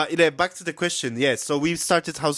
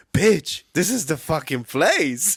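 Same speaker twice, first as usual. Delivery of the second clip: retold excitement; 3 semitones higher, slower than usual. Telling friend the pub is the venue, excited. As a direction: sell the discovery with punchy emphasis.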